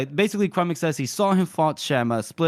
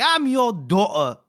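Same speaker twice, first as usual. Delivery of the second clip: mock indignant daughter; 5 semitones higher, 3 dB louder, slower than usual. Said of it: "she's like".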